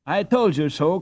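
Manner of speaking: gleeful chorus cry; triumphant cry. A narrator's reading. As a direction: crow it like a gang of kids who won. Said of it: "cried nearly all of the youngsters".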